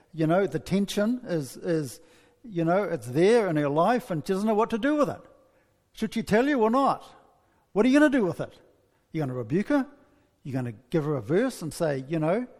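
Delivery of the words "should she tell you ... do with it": normal talking voice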